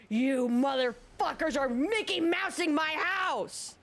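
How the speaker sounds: high, drawn-out mock scream; imitating screaming customer. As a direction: act out a furious woman screaming. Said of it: "screaming at the top of her lungs".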